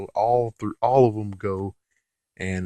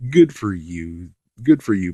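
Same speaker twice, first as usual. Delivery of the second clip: sarcastic; 6 dB louder than usual. Real, not acted. Sarcastic jab at Bandai.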